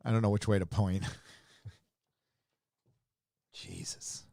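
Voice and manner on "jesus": breathy unvoiced Jesus under the breath; whispered oath. Real, not acted. Reacting to the comic art, a whispered Jesus. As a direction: let it slip out as a hushed whisper.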